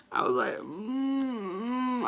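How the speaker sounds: slow, strained hum; mock struggle to eat; straining to swallow. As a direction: groan through a full mouth. Forcing noodles down.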